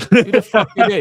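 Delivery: high, loud, dismissive put-on voice mocking outsiders; high, loud mock-snob voice scoffing at the upstarts; mocking the establishment. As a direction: sneer it like a snob who's never heard of them. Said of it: "That's how they look on us".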